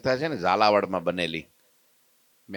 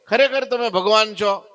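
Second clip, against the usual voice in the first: asked high and louder, in wonder; amazed question; 10 semitones higher, 5 dB louder than usual. Acted. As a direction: devotee Natha asks Maharaj if he is truly God, in amazement. Devotee questions Maharaj in story.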